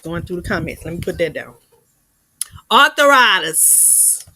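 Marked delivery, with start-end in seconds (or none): 2.7-4.2 slow, loud southern-drawled Arthritis; drawn-out southern accent mimicry; southern twang imitation. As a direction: stretch Arthritis the way the old lady does. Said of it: "I gotta say it like she said it".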